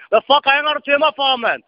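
high-pitched irate demand. Real, not acted. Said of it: "lad være med at stå og råbe".

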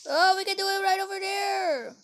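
ordinary talk

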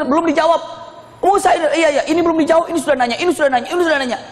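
high, overlapping clamour of questions; noisy questioners. Retold or imitated. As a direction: imitate a crowd pestering their teacher. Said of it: "Ribut nanya itu".

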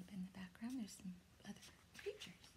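hushed whispered aside. Real, not acted. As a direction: lean in and whisper.

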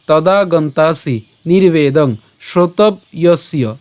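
"তদা গন্তাসি নির্বেদং শ্রোতব্যস্য" slow, piece by piece; broken-up pronunciation. Shown as a demonstration. Teacher taking the verse apart, "শ্রোতব্যস্য" split after b. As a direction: break "শ্রোতব্যস্য" after b, before ya. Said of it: "শ্লোকটি আমি ভেঙে দিয়েছি".

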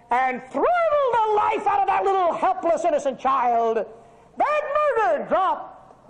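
high, loud outcry; preaching shout. Acted. Preacher crying out over the Lindbergh baby, preaching shout. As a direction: cry out against the killer.

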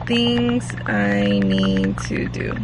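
low and very slow, as if writing; slow list-writing voice. Shown as a demonstration. To viewers while writing her to-do list, slow and drawn out. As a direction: drag each word out while jotting it down. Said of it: "do a little list".

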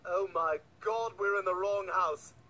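slow, low realization; dawning horror. Acted. Intruder realizing the mistake, slow dawning horror. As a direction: let it sink in slowly.

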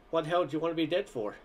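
plain reading voice

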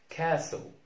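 ordinary talk, nothing marked

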